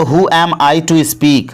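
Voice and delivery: very slow, every word laid out for learners; slow, careful model sentence; slow teaching demo. Shown as a demonstration. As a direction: spell it out slowly for the class. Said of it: "ठीक है, तो".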